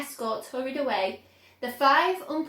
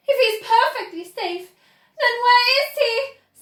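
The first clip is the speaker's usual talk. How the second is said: much higher and louder, an angry snap; snapping; 11 semitones higher, 10 dB louder than usual. A narrator's reading. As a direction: snap at him, demanding. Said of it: "snapped Missus Gloop".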